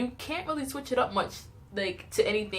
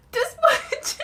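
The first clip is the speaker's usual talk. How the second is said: high squeal of laughter through the words; laughing; 5 dB louder than usual. Real, not acted. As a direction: crack up while showing how little was left. Laughing at tiny haircut.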